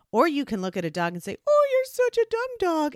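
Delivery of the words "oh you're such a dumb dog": very high, loud, gushing dog-praise voice; high happy tone. Shown as a demonstration. Owner insulting a dog as if praising it, high happy tone. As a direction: coo it sky-high, as if praising a pet. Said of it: "in this high, happy tone of voice".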